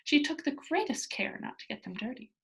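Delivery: ordinary delivery, nothing marked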